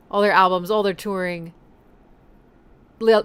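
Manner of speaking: ordinary talk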